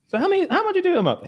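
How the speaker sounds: very high, quick mock questioning; Woody impression. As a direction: blurt it out in a high-pitched imitation. Imitates Woody's eager question.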